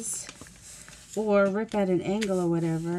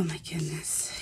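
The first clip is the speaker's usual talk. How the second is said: whispered aside; 4 semitones lower, 9 dB quieter, slower than usual. Real, not acted. Sudden realization, whispered aside.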